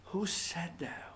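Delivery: unvoiced stage whisper; hushed aside whisper. Real, not acted. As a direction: breathe the question to the audience. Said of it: "asks in whisper after quote".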